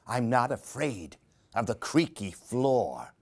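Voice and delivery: ordinary delivery, nothing marked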